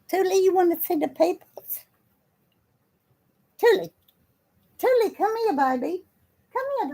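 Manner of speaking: very high sing-song pet voice. Real, not acted. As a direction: coo at the cat way up high. Talking baby talk to cat.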